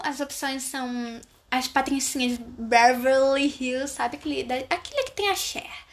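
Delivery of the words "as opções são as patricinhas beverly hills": usual speaking voice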